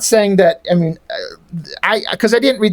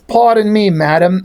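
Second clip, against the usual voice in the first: mock-polite English accent; dropped R in a put-on British apology. Troll answering Julie's charge of sexism, mock British accent.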